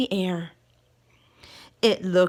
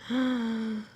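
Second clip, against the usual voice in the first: gasp; 6 dB quieter than usual. Acted. Reader reacts to the mess.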